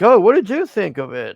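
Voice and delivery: imitated high, loud questioning; eager reporter. Reporters asking Trump about it afterwards, mock eager questioning. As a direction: jump up in pitch and volume as a pestering reporter. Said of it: "when they asked Trump about it".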